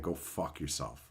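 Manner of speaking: quiet dismissal. Real, not acted. Dismissing AI rights offer.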